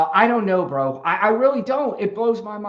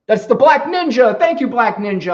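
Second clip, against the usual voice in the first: higher and louder, thrilled; excited thanks; 6 semitones higher, 8 dB louder than usual. Real, not acted. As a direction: celebrate the gifter.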